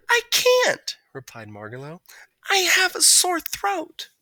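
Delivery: high, slow, sweet bird voice; a dainty songbird with a sore throat; polite little bird. A narrator's reading. Margalo telling Stuart she can't sing again.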